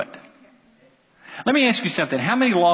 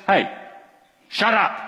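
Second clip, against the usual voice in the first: short, abrupt mock order; curt command, for demonstration; demonstrated command; 3 semitones higher than usual. Shown as a demonstration. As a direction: give it as a blunt imperative. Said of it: "That's the imperative".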